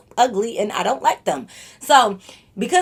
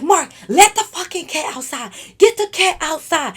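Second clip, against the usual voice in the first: higher-pitched retold command; exasperated order; 5 semitones higher than usual. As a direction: order him about, fed up. Retells ordering him about cat.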